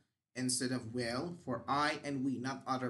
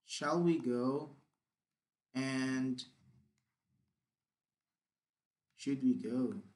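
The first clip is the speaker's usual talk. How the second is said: dictating slowly; 3 semitones lower, slower than usual. Shown as a demonstration. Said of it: "let me write it".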